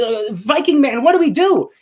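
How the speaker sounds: lightly voiced frightened soldier; panicked general. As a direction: beg the leader for orders in panic.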